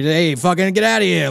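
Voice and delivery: a hint of a gruff New York voice; lightly voiced New York sleazeball; New York tough guy. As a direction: lightly toss off a Northeast tough-guy line. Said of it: "I can do a voice like that".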